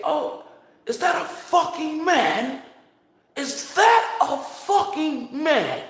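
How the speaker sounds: very high, drawn-out incredulous question; scandalized outcry, high and hammed-up; shocked disbelief. Re-enacts the man's shocked question.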